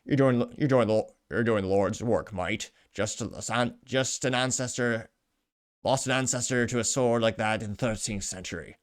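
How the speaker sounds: halting, amateur British accent; attempted British accent. Shown as a demonstration. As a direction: read it in a badly faked British accent. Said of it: "can I do a British accent?".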